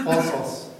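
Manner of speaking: قاصاص with long open vowels and emphatic ص, through a laugh; Arabic pronunciation. Shown as a demonstration. Teacher shows the Arabic form, laughing slightly. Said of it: "توی لهجه عربی".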